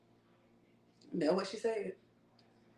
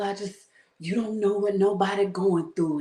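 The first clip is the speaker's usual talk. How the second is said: ordinary delivery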